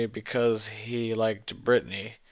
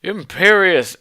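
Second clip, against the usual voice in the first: much louder, higher hammed-up spell cry; villain's shouted curse; 8 semitones higher, 11 dB louder than usual. Voldemort shouting a spell at the heroine, loud and hammy. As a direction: hurl the curse at full volume. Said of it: "Voldemort shouted".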